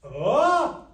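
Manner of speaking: sung glide sweeping upward; rising pitch glide. Shown as a demonstration. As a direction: slide the voice up on oh. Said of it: "Am I going up or down?".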